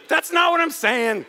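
high-pitched, insistent denial; emphatic protest. Real, not acted. Protests an imagined objection, then repeats.

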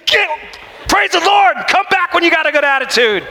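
high, shouted mock scolding. Preacher yelling at his soul, high mock shout. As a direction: yell at your soul to shape up. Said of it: "It's like".

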